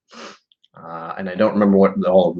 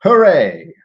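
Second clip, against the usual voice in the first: loud, high hooray; big jubilant read-aloud shout; exclaimed cheer; 8 semitones higher, 7 dB louder than usual. Acted. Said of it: "Actually, hooray".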